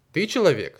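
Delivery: loud, high calling question; loud tiger question. Acted. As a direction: call out the question loudly. Tiger calls out to bison.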